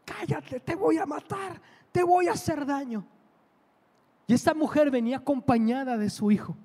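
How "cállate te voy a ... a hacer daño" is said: amenaza en tono agudo, grito contenido; grito imitado. Retold or imitated. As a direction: imita a una mujer furiosa sin subir el volumen. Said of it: "le empieza a gritar". (grito fingido, agudo y amenazante)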